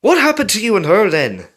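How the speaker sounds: loud, fast, high prying question; nose rudely into their business. A narrator's reading. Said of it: "Carlos rudely questioned".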